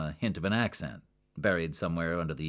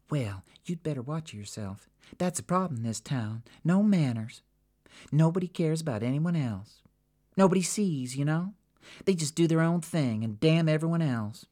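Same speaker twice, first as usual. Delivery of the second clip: higher, louder local woman on a rant; Jackie, brassy; 10 semitones higher, 3 dB louder than usual. Acted. Jackie to Sabina, ranting about the town. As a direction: warn the newcomer, building heat.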